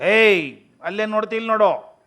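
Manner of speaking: mock classroom bark, loud first word; imitating strict teacher. Strict teacher calling a pupil to attention, mock scolding. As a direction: snap at an inattentive pupil like a stern teacher. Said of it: "ಏನು ಬಿಗಿ ಇರ್ತಾರೆ ರೀ ಕ್ಲಾಸ್ನಾಗ".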